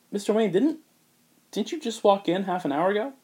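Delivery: high, puzzled receptionist asking. Retold or imitated. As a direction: rise up in disbelief at him. Confused receptionist quoting.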